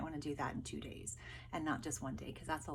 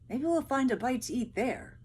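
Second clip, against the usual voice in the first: higher, louder, hopeful voice; hopeful soldier; 6 semitones higher, 7 dB louder than usual. Acted. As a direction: spot the village and perk up. First soldier voiced in story.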